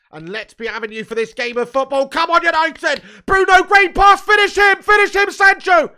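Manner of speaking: building to a high, full shout; urgent shouting. Real, not acted. Fan roars United on as the attack builds, urgent shouting. Urging team on during live attack.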